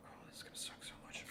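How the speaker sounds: hushed, hurried dread; dreading whisper. Real, not acted. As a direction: whisper it under your breath, bracing for pain. Dreading ripping off the bandage.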